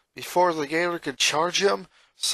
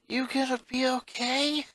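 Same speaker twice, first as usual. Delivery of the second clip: concerned sister; 10 semitones higher than usual. Acted. Older sister checking on her shaken brother, gentle concern. Luna voice.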